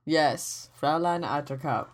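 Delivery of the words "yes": normal talking voice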